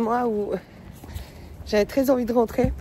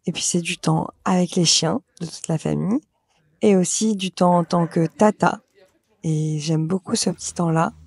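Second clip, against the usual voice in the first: louder, lower voice-over read; voice-over narration; 3 semitones lower, 6 dB louder than usual. Acted. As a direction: narrate the footage warmly.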